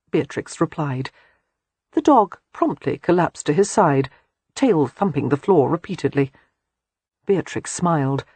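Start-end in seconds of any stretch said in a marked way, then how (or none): none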